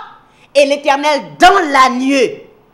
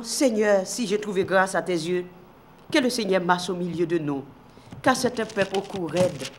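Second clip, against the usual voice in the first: humble prayer; 7 semitones lower, 10 dB quieter than usual. Acted. Moses prays humbly to God.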